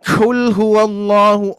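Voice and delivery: 「Qul」をゆっくり強めに; a slow, firm Qul huwallahu; qul not overdone. Shown as a demonstration. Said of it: "tidak berlebihan".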